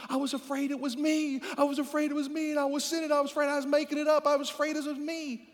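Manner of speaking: high, insistent mock worry; worried church member. Church member confessing doubts to the pastor, high and fretful. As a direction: fret in a high, rushed run.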